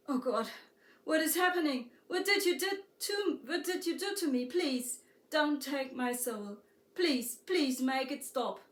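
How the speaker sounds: pleading in fear. A narrator's reading. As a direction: beg her desperately to make it stop. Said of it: "the wanderer pleaded the girl".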